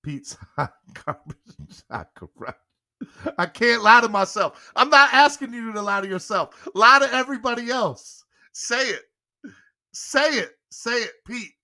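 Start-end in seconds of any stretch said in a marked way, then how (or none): 0.0-2.6 slow, giggling read of the words; laughing slowly. Real, not acted. Streamer reads friend's chat reply while laughing slowly. 4.8-11.5 higher, louder insistent pushing; urgent pleading. Real, not acted. Streamer urges Pete higher and louder to say it for donations.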